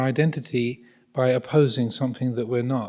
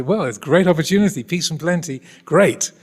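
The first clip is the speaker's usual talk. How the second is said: higher, louder and quicker, brightly upbeat; cheery contemplatives; 5 semitones higher, 6 dB louder than usual. Retold or imitated. Buddhist contemplatives welcome peace as an opportunity, in a mock-cheery voice. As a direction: gush over it like a delighted monk. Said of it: "and they say".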